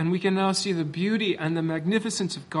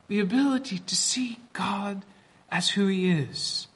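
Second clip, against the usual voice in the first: whispering; 3 semitones higher, 4 dB quieter than usual. Acted. Whispered aside.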